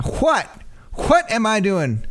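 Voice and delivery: Southern lawyer acting offended. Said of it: "you're doing it right now".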